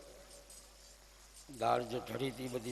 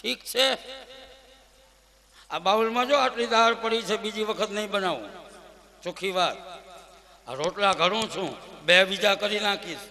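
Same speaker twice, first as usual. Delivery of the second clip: louder, higher warning that builds to a shout; wife warning husband never to ask for more; stern scolding wife; 4 semitones higher, 10 dB louder than usual. Acted. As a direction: lay down the law, peaking at the threat. Wife scolds, forbids second helping.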